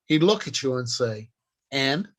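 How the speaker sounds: usual speaking voice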